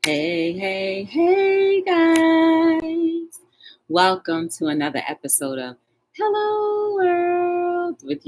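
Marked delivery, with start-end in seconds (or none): 0.1-3.4 much higher, louder sing-song hello; high greeting. Real, not acted. Sing-song greeting to live viewers. 6.2-8.2 much higher, slow, drawn-out show title; show intro. Real, not acted. Announces show name.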